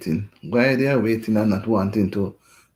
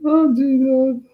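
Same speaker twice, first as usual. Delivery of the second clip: laughing exclamation; 14 semitones higher, 5 dB louder, slower than usual. Real, not acted. After laughing fit.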